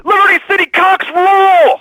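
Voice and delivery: high, loud, drawn-out cheer; fan cheer. Acted. Caller shouting support for his team to the radio host, loud slow cheer. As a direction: bellow it like a sports chant.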